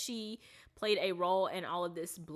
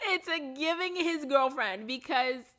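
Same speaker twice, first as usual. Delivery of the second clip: higher and louder, bursting with glee; excited outburst; 8 semitones higher, 7 dB louder than usual. Real, not acted. Gushing to viewers about the actor's girlfriend, excited. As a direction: blurt it out, delighted. Repeats the line, much louder.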